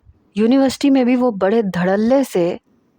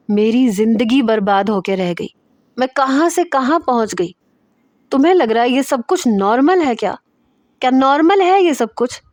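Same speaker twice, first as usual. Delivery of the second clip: high, loud, hurt and furious; angry outburst; 4 semitones higher, 3 dB louder than usual. Acted. Natasha venting her pain at Zain before crying, high angry shout. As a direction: let it burst out of her, pitch climbing.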